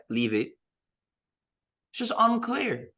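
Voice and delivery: ordinary delivery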